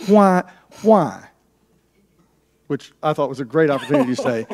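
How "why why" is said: mock French-accented "why", pitched higher; slight French accent. West African kid comes back to question his host, slight French accent. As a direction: imitate a French-accented kid's plaintive question. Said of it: "a little bit of a French accent".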